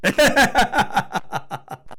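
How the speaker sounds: high scornful laugh; cheeky son laughs at father; mocking laugh. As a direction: laugh at the old man.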